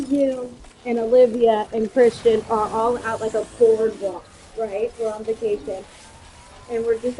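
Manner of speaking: usual speaking voice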